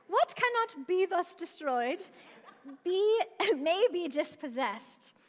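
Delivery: laughing through a quote. Real, not acted. Laughing after joking about Scottish accent.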